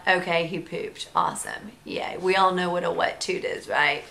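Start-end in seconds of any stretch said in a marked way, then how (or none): 0.1-2.2 soft, dry, deadpan sarcasm; mock sarcastic relief. Mom thinking to herself about a wet toot, softly sarcastic. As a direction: mutter it under your breath, sarcastic. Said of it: "and I thought".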